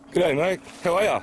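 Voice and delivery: high, loud friendly call. Real, not acted. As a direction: hail him from afar. Calling out to distant man.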